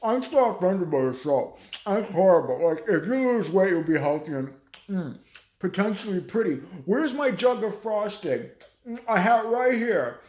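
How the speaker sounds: loud, whiny put-on voice of an offended fan; hammed-up complainer gushing over frosting; mocking offended viewer. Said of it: "You're like".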